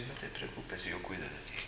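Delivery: suave y mucho más bajo, consolando; tranquilizador. Acted. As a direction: como quien consuela a un niño. Consuela a la emoción como a un niño. (voz baja y tierna para consolar a la emoción)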